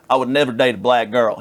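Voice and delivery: light mock Southern bigot voice; mock Southern accent. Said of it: "He didn't have that accent".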